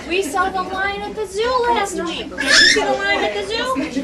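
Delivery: high, slow, excited parent voice; talking to a toddler. Shown as a demonstration. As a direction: show the lion to a small child. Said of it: "you could say".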